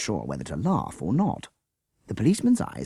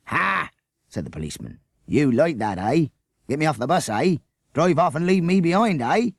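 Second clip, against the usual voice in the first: louder, higher suspicious mockery; scoffing policeman; 9 semitones higher, 5 dB louder than usual. A narrator's reading. Policeman refusing to leave the bus, scoffing. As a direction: sneer through every eh.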